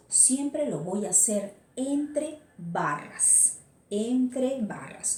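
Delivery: "entre barras" said slowly, each word weighed; slow emphasis on entre barras. Shown as a demonstration. Teacher to students, slow emphasis on "entre barras". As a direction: drag out "Entre barras" so it sticks. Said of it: "no te vayas a olvidar".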